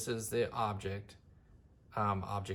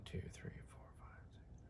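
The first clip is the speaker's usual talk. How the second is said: barely audible whispered count; counting under breath; 23 dB quieter than usual. Real, not acted. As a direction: whisper the numbers to yourself. Counting to himself while measuring.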